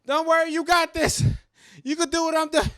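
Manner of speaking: high, loud hammed-up hero call; loud mock-brave farewell to his stud; mock heroic reassurance. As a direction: shout encouragement while running away. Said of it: "And I'm be like".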